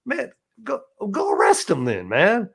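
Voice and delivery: high, exasperated retort with a laugh breaking in; exasperated protest. Retold or imitated. Inmate arguing with guards over the medical staff, exasperated and high-pitched.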